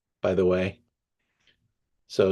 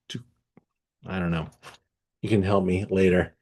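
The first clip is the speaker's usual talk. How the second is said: ordinary talk, nothing marked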